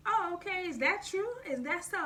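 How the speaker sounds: high, sing-song sarcastic questions; mock surprise. To the aunt reporting the ex's gossip, sarcastic mock surprise. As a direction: feign innocent interest. Hearing ex snitched.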